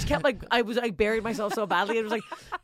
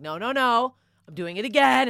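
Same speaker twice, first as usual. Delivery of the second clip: louder, higher, determined; pissed insistence; 6 semitones higher, 9 dB louder than usual. Retold or imitated. Comic at taping after a flub, loud pissed insistence. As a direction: refuse to let the flub stand. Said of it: "then I got pissed".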